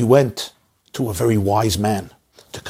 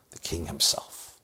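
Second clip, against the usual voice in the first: hushed awe; 8 semitones lower, 11 dB quieter, slower than usual. Acted. Man names his last hope.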